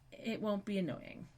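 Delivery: ordinary talk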